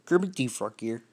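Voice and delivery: put-on puppet frog voice; Kermit the Frog. Shown as a demonstration. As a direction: introduce yourself as a cartoon frog puppet. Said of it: "turn you into fucking Kermit the Frog".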